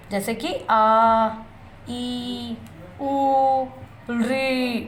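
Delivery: आ, ई, उ, ऋ each drawn out long and slow, mouth wide open; long vowel demo. Shown as a demonstration. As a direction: stretch every vowel to twice its length. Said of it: "जब हम दीर्घ स्वर का उच्चारण करते हैं". (हर दीर्घ स्वर धीरे और खींचकर, मुँह पूरा खोलकर)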